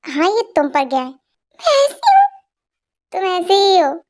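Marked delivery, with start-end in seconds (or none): 1.6-2.4 indignant surprise. Acted. Wife indignant at comparison.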